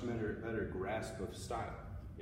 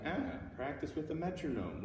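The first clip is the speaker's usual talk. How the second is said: mock objection; 6 semitones higher than usual. Music teacher imitating a student who objects to speeding up, mock protest. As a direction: lightly mimic a complaining student. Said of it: "you might be like".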